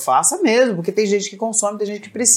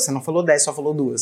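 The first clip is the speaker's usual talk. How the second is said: low, softer deadpan retort; retold comeback; 6 semitones lower, 3 dB quieter than usual. Son answering back to his scolding mom, deadpan retort. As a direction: drop the voice for a child's dry correction. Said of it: "Ele é esse que o Tony rebate".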